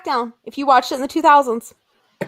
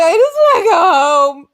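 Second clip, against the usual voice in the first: whiny, louder, half-sobbing plea; mock crying; 6 dB louder than usual. Retelling a wrestling show meltdown, mock tearful whine. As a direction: fake a teary wail to go home.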